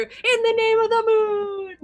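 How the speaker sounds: high, loud anime heroine cry; bright magical girl. Acted. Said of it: "somewhere in the distance you hear".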